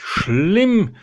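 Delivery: usual speaking voice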